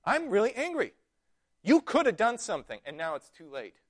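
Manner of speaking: Mary telling Jesus he's too late, mildly angry; mild angry rebuke. Retold or imitated. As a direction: let grief spill out as blame. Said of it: "No, it's just".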